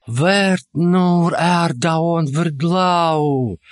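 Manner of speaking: slow, reverent exclamation; exclaiming in unison. A narrator's reading. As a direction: call out the full name in awe. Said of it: "воскликнули в один голос эльфы".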